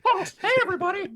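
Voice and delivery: high, fast cartoon squeak; a Disney-style cartoon character greeting. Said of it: "We're gonna get sued by Disney".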